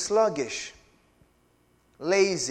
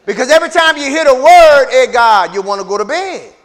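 louder, higher rebuke; loud preaching; 5 semitones higher, 13 dB louder than usual. Real, not acted. Preacher calling out the sleepy congregation, loud. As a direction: drive it home to the sleepers.

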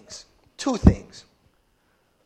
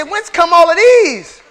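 louder, higher, slow wonder; amazed exclamation; 6 semitones higher, 9 dB louder, slower than usual. Retold or imitated. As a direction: marvel at all the children. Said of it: "You're gonna say".

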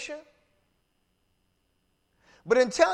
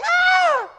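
loud, high scream of someone bitten; pained scream; 10 dB louder than usual. Acted. Preacher acting out a dog-bite victim, screaming. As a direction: cry out at the top of your voice. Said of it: "you gonna scream, you gonna say".

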